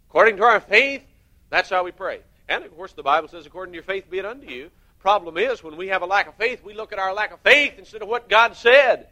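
loud, high preaching to the congregation; fiery preaching. Acted. Preacher urging the congregation to look at God's promises, loud and driving. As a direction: hammer it home from the pulpit.

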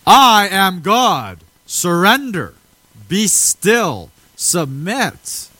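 loud, high, thundering divine proclamation; booming orders to surrender, each one set apart; commanding declaration. Acted. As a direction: declare it slowly as an absolute command. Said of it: "He ain't whispering in somebody's ear".

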